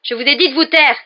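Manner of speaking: high, very loud, furious; angry shout. A narrator's reading. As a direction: hurl it at him. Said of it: "s'exclama Harry".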